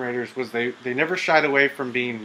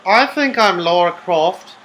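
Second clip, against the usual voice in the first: slow, higher, mock-Australian-sounding accent; put-on Lara Croft voice; 3 semitones higher, 6 dB louder, slower than usual. Player posing as Laura Croft, put-on accent. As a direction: play a fancy lady in a drawling, badly faked accent. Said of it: "doing whatever voice I could think of".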